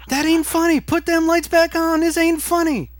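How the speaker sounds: imitating a woman yelling from her shower in the dark; woman screaming.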